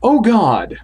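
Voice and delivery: startled gasp. Acted. Startled exclamation in dark.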